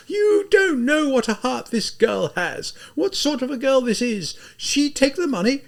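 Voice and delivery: high, tearful desperate pleading; weeping shout. Acted. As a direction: sob and shout at once in despair. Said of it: "in this hoarsely shouting voice, in this violent weeping".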